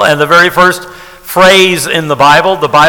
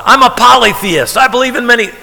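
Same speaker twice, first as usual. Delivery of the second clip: mock polytheist; 8 semitones higher than usual. Mock objector voicing the polytheist.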